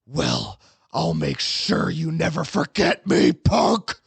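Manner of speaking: threat building to a high, loud finish; demonic voice. Acted. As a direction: menace that climbs to a yell. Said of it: "in a demonic voice".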